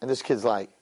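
ordinary delivery

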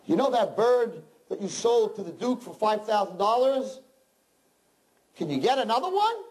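peasant's eager voice. Acted. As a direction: ask with rising hope. Peasant asks pet store owner.

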